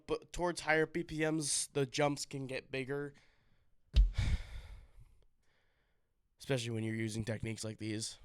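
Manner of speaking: breathless between drum strokes; winded, short of breath. Real, not acted. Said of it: "I don't know why I'm so out of breath".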